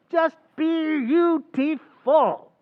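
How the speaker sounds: slow, high, drawn-out sarcasm; mock delight over terrible figures; sarcastic praise. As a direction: stretch it out mockingly, pitched high. Sarcastic report of red-ink losses.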